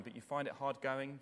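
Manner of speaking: usual speaking voice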